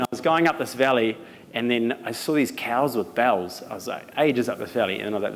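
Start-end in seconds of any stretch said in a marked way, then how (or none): none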